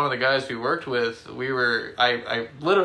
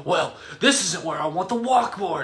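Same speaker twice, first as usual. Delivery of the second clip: angry boss; 6 semitones higher than usual. Retold or imitated. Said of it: "He's like".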